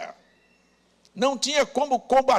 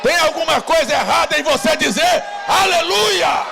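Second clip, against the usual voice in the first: loud, raised call building to a hallelujah; rousing preacher's shout; 5 semitones higher, 5 dB louder than usual. Real, not acted. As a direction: shout it to stir the crowd. Urging congregation to shout praise. (brado de pregador chamando o aleluia)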